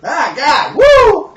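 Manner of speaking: high, loud whoop of delight; excited whoop. Real, not acted. Friend whoops hearing the door story, loud and high. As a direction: let out a big woo.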